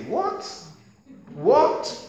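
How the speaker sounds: high, rising what; incredulous high what; mock shock. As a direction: play it astonished. Mock astonishment at result.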